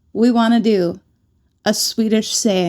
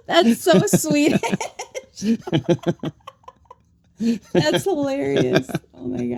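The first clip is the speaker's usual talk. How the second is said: laughing; 3 semitones higher than usual. Real, not acted. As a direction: laugh through the words. Laughing at the saying.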